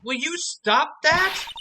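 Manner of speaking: usual speaking voice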